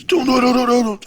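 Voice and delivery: high, rapid-fire imitation of noise; imitated commotion. Storyteller imitating the noise from behind a door, high imitated commotion. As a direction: mimic a racket heard through a door. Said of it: "all I hear is".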